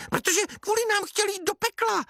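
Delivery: very high, squeaky little voice; squeaky dwarf chorus. A narrator's reading. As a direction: pipe up like tiny dwarfs. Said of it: "řekli trpajzlíci".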